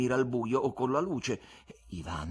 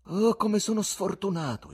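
high, louder lament; dismayed exclamation; 11 semitones higher, 5 dB louder than usual. A narrator's reading. Guest learning Ivan is a poet, dismayed outcry. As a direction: cry out at your bad luck. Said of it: "esclamò, ma si riprese subito".

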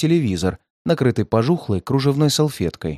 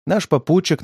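plain reading voice

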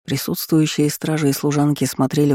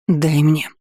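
trembling voice; 2 semitones higher than usual. A narrator's reading. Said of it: "Её голос дрогнул".

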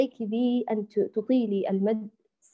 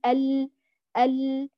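ordinary delivery

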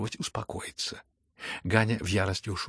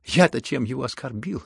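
loud, insistent, mocking protest; 6 dB louder than usual. A narrator's reading. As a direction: insist with a touch of mockery. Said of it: "тем же насмешливым тоном".

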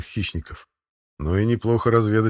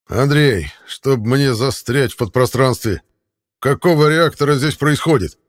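put-on loud, higher shout of an old admiral; loud booming voice; 6 semitones higher, 6 dB louder than usual. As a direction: bellow it across the field, startled. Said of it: "Раздался громкий голос вице-адмирала". (громкий зычный голос)

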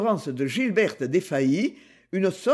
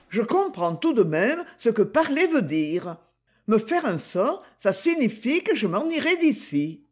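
put-on higher, lively girl's voice; higher, playful young girl; Gigi's voice; 4 semitones higher than usual. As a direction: play the teasing young girl greeting her uncle. Gigi voiced in read story.

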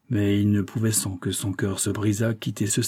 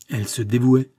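ordinary delivery

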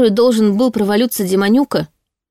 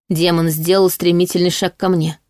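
plain reading voice

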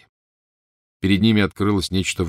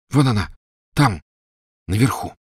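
excited; 3 semitones higher, 3 dB louder than usual. A narrator's reading. Harry spots cup.

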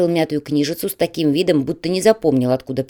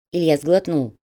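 plain reading voice

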